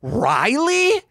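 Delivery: high, incredulous squeak of a name; disbelieving dad asking, voice jumping up; dad's incredulous tone. Retold or imitated. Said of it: "I've heard my dad use that tone of voice".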